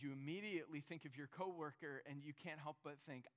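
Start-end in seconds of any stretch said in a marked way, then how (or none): none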